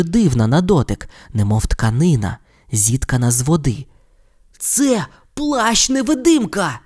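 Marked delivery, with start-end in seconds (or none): 4.6-6.8 high and slow with wonder; excited exclamation. Acted. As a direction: cry it out in delight at the cloak. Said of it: "захоплено вигукнув Рон".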